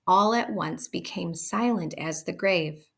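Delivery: plain reading voice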